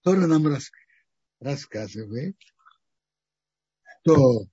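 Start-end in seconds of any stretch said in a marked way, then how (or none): none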